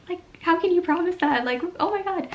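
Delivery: can she really promise that?; incredulous. Retold or imitated. Retold disbelief at the promise.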